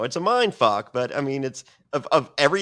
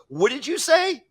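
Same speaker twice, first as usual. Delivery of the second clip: mock confrontation; 3 dB louder than usual. [name] confronting Joe Rogan.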